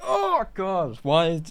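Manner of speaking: usual speaking voice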